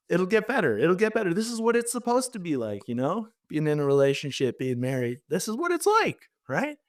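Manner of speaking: high-pitched, strained reassuring voice; mock self-reassurance. Convincing himself the marriage is normal, high mock-reassuring voice. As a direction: talk yourself into it in a high voice.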